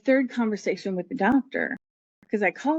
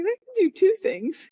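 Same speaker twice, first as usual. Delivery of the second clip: mock relieved excitement; 11 semitones higher than usual. Relieved realization, retold quote.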